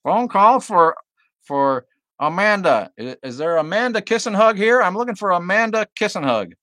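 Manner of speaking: high, loud Bart Simpson prank caller; crank-call voice. Retold or imitated. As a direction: ham up a kid's crank call to the bar. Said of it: "crank call style stuff".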